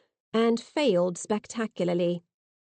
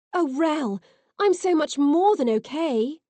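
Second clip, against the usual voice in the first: overjoyed; 6 semitones higher than usual. Acted. Reunited, overjoyed.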